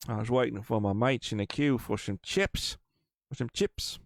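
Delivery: put-on East London Cockney accent; Cockney attempt slipping toward Kiwi. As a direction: do a hammy British Cockney. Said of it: "East London Cockney accent".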